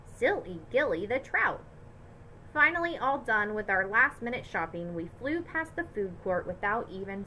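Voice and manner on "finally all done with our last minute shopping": ordinary delivery, nothing marked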